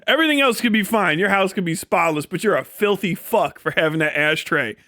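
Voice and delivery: ordinary talk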